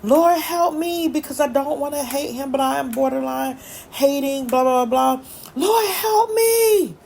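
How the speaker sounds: high, drawn-out mock cry for help; wailed prayer, acted out without a full scream; retold scream. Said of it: "I literally screamed out".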